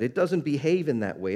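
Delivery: usual speaking voice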